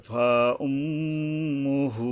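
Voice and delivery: ফা উম্মুহু very slow, long hummed m; gunnah. Shown as a demonstration. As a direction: chant ফা উম্মুহু, holding mim and final u. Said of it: "এখানে গুণনা করতে হবে". (ফা উম্মুহু গুন্নাহ করে টেনে পড়া)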